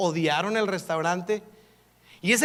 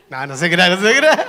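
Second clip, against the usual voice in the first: loud laughing "just kidding"; 11 dB louder than usual. Real, not acted. Pastor teasing his congregation, laughing loudly. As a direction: burst out laughing as you take it back.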